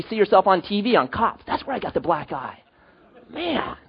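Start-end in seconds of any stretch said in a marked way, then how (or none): 3.3-3.8 high, soft, drawn-out wondering; hushed pretend bewilderment; mock puzzlement. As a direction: puzzle over it like a clueless drunk. Plays the puzzled drunk afterwards.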